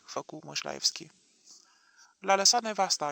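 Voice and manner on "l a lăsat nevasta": plain reading voice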